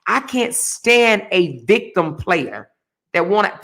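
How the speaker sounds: ordinary talk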